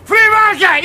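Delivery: full ranting bellow of free market. Acted. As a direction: roar it at the street. Ranting repeat.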